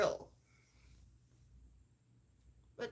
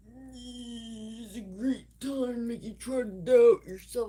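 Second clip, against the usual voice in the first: much louder, slow, sneering put-down; mocking roommate; 13 dB louder, slower than usual. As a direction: loom over her with a loud taunt. Roommate quoted putting her down.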